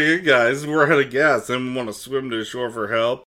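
loud, high mock call to the group; joking announcement. Pilot addressing stranded passengers, jokey shout. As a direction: call out the absurd offer cheerily. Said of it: "he was specifically joking".

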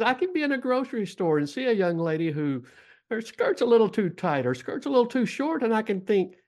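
lightly imitating Lisa's accent; a hint of Lisa's accent, chatty. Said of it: "in Lisa's accent".

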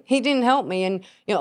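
usual speaking voice

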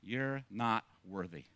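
low, slow, soft taunting of the enemy; a hushed, hint-of-sinister accuser; quiet accusing voice. Retold or imitated. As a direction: lean in and sneer it quietly. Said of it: "And he says".

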